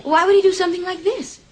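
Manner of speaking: high-pitched, appalled question; shocked disbelief. Acted. Woman appalled at what Mason did, shocked.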